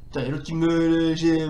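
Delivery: ordinary delivery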